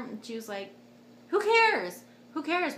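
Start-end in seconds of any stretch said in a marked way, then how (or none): 1.3-2.8 high, loud mock dismissal; exclaimed dismissal. Mom waving off labor worries, exclaimed dismissal. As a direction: brush it off with a high retold exclamation.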